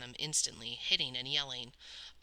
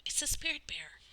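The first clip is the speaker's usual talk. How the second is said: awed hush; 12 semitones higher than usual. A narrator's reading. Awed whisper at the bear.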